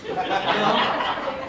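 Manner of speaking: high mocking taunt. Mocking the neighbor's photo threat, high and scornful. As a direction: sneer back at him in a high voice. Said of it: "I go".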